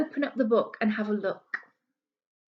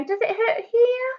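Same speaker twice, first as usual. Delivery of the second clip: much higher, caring doctor voice; doctor asking gently; 10 semitones higher, 3 dB louder than usual. Acted. Doctor gently asking the girl where it hurts. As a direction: coax the child to show where it hurts. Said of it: "and she'll say".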